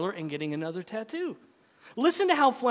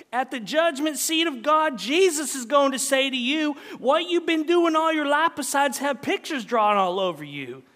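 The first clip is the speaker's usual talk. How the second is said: higher, louder righteous scolding; raised-voice country wife telling off her husband; 8 semitones higher, 6 dB louder than usual. Acted. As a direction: read it as a wife laying down God's judgment. Wife's scolding in story reading.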